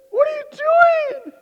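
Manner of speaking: high, loud and slow, aghast; horrified wife. Retold or imitated. Wife scolds husband over shoyu on rice, aghast and shrill. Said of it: "My wife goes".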